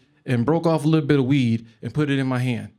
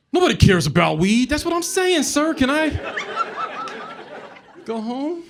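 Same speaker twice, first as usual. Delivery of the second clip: whiny plea; 12 semitones higher than usual. Retold or imitated. Pleading with the cops.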